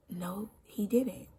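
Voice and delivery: soft, slow, near-whispered disbelief; stunned whisper. Retold or imitated. Recalling being spat on, a hushed stunned whisper. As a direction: breathe it out in quiet shock.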